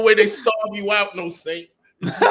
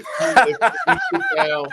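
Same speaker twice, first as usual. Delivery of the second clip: laughing; 5 dB louder than usual. Real, not acted. Laughing while he threatens.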